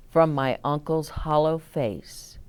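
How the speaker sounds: ordinary delivery, nothing marked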